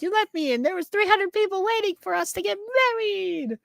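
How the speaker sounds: very high, quick mock tirade; hammed-up scorned woman; jilted bride. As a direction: rattle off the grievance in a shrill put-on voice. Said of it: "and she's like".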